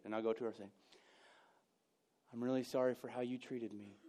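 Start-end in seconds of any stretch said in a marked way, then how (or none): 2.3-4.0 sarcastic. Shown as a demonstration. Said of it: "a sarcastic idiot".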